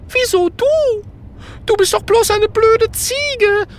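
very high, whiny ghost voice; scornful ghost. A narrator's reading. Ghost dismissing the goat, scornful. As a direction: sneer it in a high-pitched wail.

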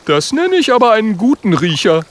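put-on high, loud, admiring young voice; Oskar impressed. Oskar admiring Björn's nose, high and loud. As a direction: praise the bear's nose. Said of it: "sagt Oskar".

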